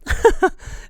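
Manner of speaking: high breathy burst of laughter. Real, not acted. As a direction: crack up.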